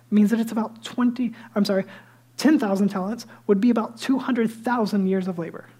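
ordinary talk, nothing marked

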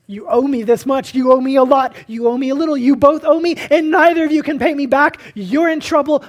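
loud, high, pressing demand; demanding creditor. Retold or imitated. Creditor loudly demanding payment from the debtors. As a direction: call in the debt forcefully. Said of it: "he says".

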